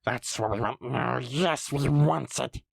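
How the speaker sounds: slither the words like a cave creature; wheedling Gollum with gulping gollums. Acted. Gollum's longing.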